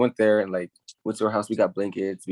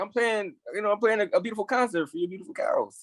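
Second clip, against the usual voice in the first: high, softer mock-innocent answer; innocent retold reply; 11 semitones higher, 3 dB quieter than usual. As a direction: explain sweetly in a pitched-up voice. Retold answer to the yelling farmer.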